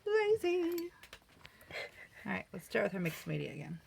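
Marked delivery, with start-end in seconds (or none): 0.0-0.9 high, slow drawn-out whine; high slow self-mocking whine; mock lazy whine. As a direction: whine it like a kid caught out.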